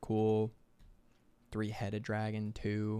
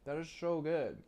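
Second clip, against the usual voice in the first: chewing while talking; 6 semitones higher than usual. Real, not acted. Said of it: "Sorry, I'm chewing on stream".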